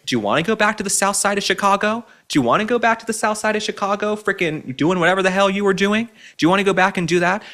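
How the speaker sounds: high-pitched taunting questions; scolding challenge. Real, not acted. Host challenging Michelle Obama over her complaints, taunting. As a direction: needle her with rising, incredulous questions.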